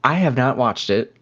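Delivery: normal talking voice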